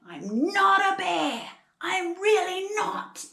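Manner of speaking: high, slow, indignant storybook shout; hammed-up koala outburst in an Australian accent. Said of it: "I'm sorry if I shout".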